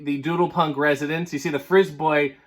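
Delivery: ordinary delivery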